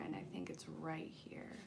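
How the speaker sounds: soft whispered aside while hunting. Real, not acted. Reader finding a fallen card, whispering to herself. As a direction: whisper it to yourself, searching. Searching cards, aside to self.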